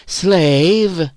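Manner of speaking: high, drawn-out jeer. A narrator's reading. Phyllis mocks Virginia for wanting a man, jeering. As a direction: fling it as a taunt. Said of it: "jeered Phyllis".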